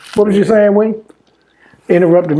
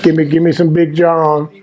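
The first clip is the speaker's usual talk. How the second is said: ordinary talk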